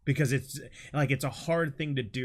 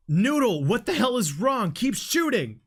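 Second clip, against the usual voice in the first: shouting; 8 semitones higher, 5 dB louder than usual. Acted. Soldier yelling at teammate mid-fight.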